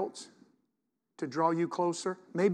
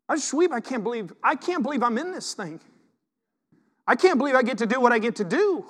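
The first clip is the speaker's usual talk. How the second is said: higher, louder, almost tearful wonder; overwhelmed; 6 semitones higher, 4 dB louder than usual. Real, not acted. Pastor telling the church he can't believe his calling, overwhelmed. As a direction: let the gratitude spill over.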